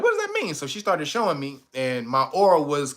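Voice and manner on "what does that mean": high, softer confused question; baffled question. Retold or imitated. Told his aura is ancient, high baffled question. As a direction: ask it squeaky and lost.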